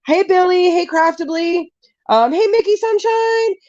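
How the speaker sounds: high, cheery hellos; bubbly, lifted welcome; excited greeting. Real, not acted. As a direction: call out happily to friends arriving. Breaks off to greet new arrivals.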